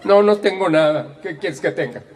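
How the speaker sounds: high, loud mock huffy retort; hammed-up touchy comeback; sulky denial. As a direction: deny anything is wrong, clearly put out. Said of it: "el tono de voz es más o menos así".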